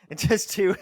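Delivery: laughing through a self-correction; real laugh at your own slip. Real, not acted. Caster who can't count players, laughing at himself.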